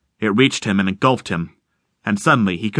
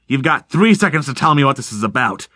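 usual speaking voice

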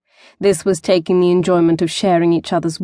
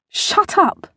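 high squeaked shut up; mortified girl squeaking at her lover; 12 semitones higher than usual. A narrator's reading. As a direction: squeak it out in embarrassment. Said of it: "squeaked Tessa".

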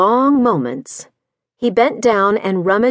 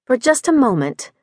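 ordinary delivery, nothing marked

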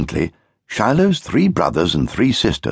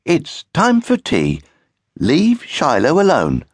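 mother; 9 semitones higher than usual. Acted. Mother voice continues.

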